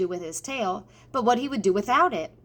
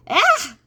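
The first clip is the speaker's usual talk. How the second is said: high, loud cry of shock; startled shriek; 11 semitones higher, 9 dB louder than usual. Acted. Cook spotting a mouse in her oil, shrieking. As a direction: yelp at the sight of a mouse.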